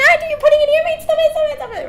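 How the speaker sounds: high, loud, frantic begging to stop it; panicked pleading. Retold or imitated. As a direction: imitate a girl shrieking at her friend. Girl panicking about the wasp.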